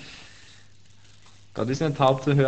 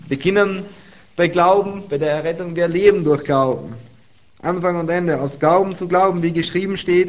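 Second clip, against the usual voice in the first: raised, energetic Bible reading; fervent scripture reading; 4 semitones higher, 4 dB louder than usual. Acted. Preacher reading Romans to the congregation, fervent. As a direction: preach the verse with lifted voice.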